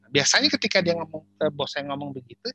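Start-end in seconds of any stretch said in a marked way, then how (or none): none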